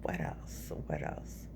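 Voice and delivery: unvoiced whisper to herself. Real, not acted. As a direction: breathe it, no voice.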